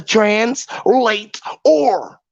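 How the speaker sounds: "translate or" slowly split into separate words; word broken apart. Shown as a demonstration. Speaker shows how to answer for a translator, "translate or" slowly broken up. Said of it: "you just say it like that".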